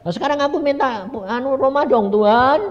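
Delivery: high, wheedling retold plea; childlike pleading. Asking God for a house, high pleading. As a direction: beg in a high voice, stretching the end.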